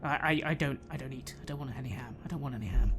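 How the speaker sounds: soft, low, halting refusal; hushed, reluctant mumbling to yourself; queasy refusal. Acted. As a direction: murmur it, withdrawn and unwell. Sickened character refuses the food.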